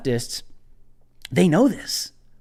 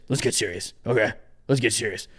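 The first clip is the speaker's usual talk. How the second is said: lower, much softer mock-solemn voice; mock serious; 9 semitones lower, 8 dB quieter, faster than usual. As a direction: drop into a fake grave tone to compose himself.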